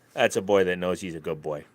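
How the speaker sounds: usual speaking voice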